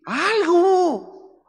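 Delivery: emphatic exclaim. Real, not acted.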